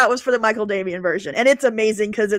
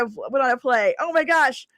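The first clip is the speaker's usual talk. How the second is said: ordinary talk, nothing marked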